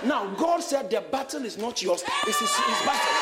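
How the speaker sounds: loud, emphatic sermon voice; forceful preaching. Real, not acted. Preacher telling the church whose battle it is, forceful preaching. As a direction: drive it home to the congregation.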